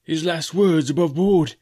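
slowed, mournful pirate; mild moan. Acted. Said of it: "moaned Morgan".

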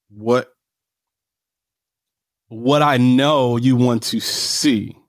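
ordinary talk, nothing marked

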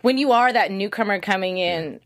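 ordinary talk

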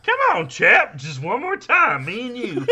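very high, loud goofy coaxing; John C. Reilly catcher voice. Acted. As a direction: coax the pitcher in a goofy high voice. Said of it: "and then I did the voice".

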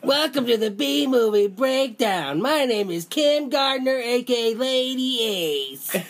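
put-on high, slow little girl voice. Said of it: "You're gonna do it in a little girl voice".

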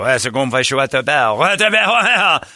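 mock French rambled at a passerby; fake French accent. Comic to a homeless man, in put-on fake French. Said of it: "speaking tons of fake French".

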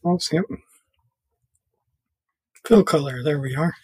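ordinary delivery

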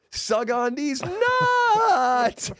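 very high, slow, drawn-out yell; prank punchline shout. Retold or imitated. Speaker yells the prank punchline at the taxi guy, slow high shout. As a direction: belt out the punchline slowly. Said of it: "I'm like".

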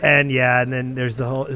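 normal talking voice

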